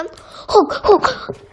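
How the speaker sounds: gasping, tearful disbelief; choked-up gasps. Acted. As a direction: gasp through happy tears. Overwhelmed by receiving fortune.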